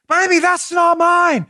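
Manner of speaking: high, drawn-out comic denial; mock-indignant and high; Austin Powers. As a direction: protest slowly in a silly high voice. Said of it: "He's like".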